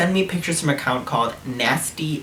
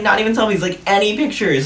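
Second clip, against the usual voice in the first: excited disbelief; 8 semitones higher than usual. Retold or imitated. Teasing a guy about his lack of photos, excited. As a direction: push back in playful disbelief.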